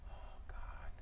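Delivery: breathy under-the-breath oh God, said to herself; whispered oh God. Real, not acted. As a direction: mutter it to yourself while stuck on the tech. Fumbling with the video playback.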